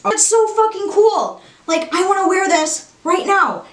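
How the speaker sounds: high-pitched fangirl excitement; excited gushing. Real, not acted. Horror fan shows viewers her new shirt, high excited gushing. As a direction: gush in a high, thrilled voice.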